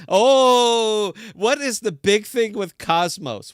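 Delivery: mock the chain's big promise; exasperated rant. Real, not acted. Host rants at Cosmos chains, high and exasperated.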